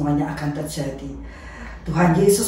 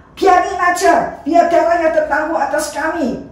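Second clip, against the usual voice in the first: defiant crowd shout; 9 semitones higher, 5 dB louder than usual. Acted. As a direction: hurl it back defiantly. Crowd accepts blame.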